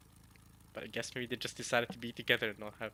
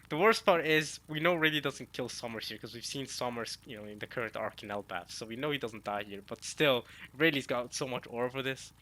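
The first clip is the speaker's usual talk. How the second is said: ordinary talk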